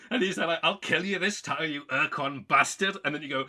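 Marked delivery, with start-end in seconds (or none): 0.6-3.0 mock angry threat. Alien threatens the player.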